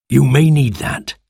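ordinary delivery, nothing marked

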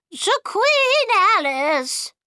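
high, drawn-out toast cry. A narrator's reading. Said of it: "she cried".

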